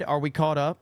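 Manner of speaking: ordinary talk, nothing marked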